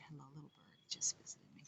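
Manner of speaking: soft whisper to a little bird. Real, not acted. Whispering to a bird at the window, hushed. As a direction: hush your voice for the bird.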